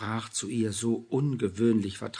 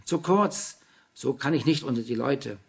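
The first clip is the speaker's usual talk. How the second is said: louder, calling out Nein with a fall; ringing call; 3 semitones higher, 6 dB louder than usual. A narrator's reading. Anna calling from the changing room, loud. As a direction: call it out from behind a door. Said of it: "schallte es aus der Kabine".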